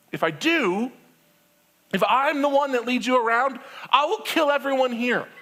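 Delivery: a God at the end of his rope; exasperated God. Acted.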